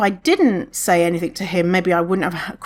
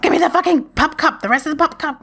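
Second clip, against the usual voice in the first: greedy dog voice; 9 semitones higher, faster than usual. Pretend. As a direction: voice the dog begging for more. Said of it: "Bella was like".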